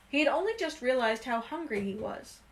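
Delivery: usual speaking voice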